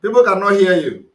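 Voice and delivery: calling out loudly. Real, not acted. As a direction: raise your voice so the faint caller hears. Host shouting over a faint line.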